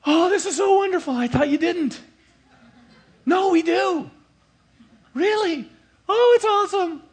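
offended man relieved after reconciliation, high gushing; mock gushing relief. Squeal it out with delight.

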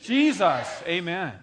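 Jesus shouted out; shouted answer to the congregation; loud. Shown as a demonstration. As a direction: call the name out loud. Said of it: "say Jesus really loud this time".

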